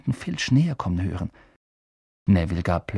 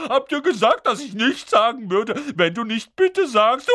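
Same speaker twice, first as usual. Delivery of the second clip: high, loud teasing singsong; Peeves, gloating; 12 semitones higher, 4 dB louder than usual. A narrator's reading. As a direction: gloat like a mischievous poltergeist. Peeves mocks Filch in triumph.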